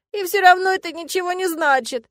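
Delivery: high, loud plaintive protest; whimpering objection, pitched high; tearful insistence. A narrator's reading. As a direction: insist through tears. Heroine holds back tears.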